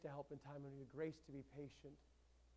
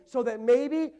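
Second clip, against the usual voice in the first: loud, high, driving sermon delivery; preaching at full force; 10 semitones higher, 24 dB louder, much slower than usual. Acted. Pastor urging the church to pray boldly, preaching full force. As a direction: pound it out to the whole congregation.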